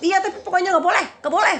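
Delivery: high, loud, fast mock refusal; hammed-up high shouting; imitated angry scolding. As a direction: act out a man angrily shutting down an argument. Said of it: "marah-marah ke saya ya".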